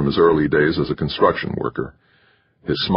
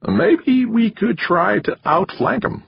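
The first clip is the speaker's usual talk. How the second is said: higher, slow needling manager; wiry manager voice; slower than usual. A narrator's reading. Manager spelling out the loss to the candidate, higher and slow. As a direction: lay it out to him like a lecture. Narrator voicing McChesney.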